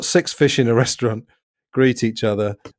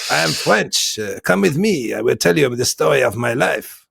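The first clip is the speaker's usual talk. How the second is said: put-on French accent, higher at the start; 3 semitones higher than usual. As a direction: imitate a singing Frenchman with a stagey French accent. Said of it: "Eric Idle with a French accent".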